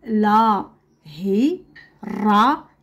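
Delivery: each syllable set apart by a pause. Shown as a demonstration. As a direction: sound out "هي را" in separate syllables. Teacher sounds out word in syllables.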